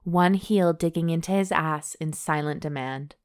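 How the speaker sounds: steady read-aloud storytelling voice; romance story narration. A narrator's reading. Host reading a steamy novel to her cohost, narration voice. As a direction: read the love scene out like an audiobook.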